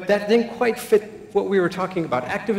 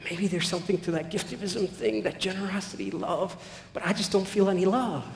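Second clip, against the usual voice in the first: hushed inner voice; 7 dB quieter than usual. Retold or imitated. Buddy's inner voice, hesitant.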